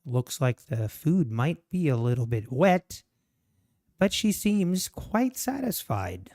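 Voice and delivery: hushed, measured wildlife commentary; nature documentary narration. Pretend. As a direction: narrate the goldfinch like a nature show.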